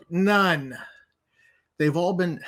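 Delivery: usual speaking voice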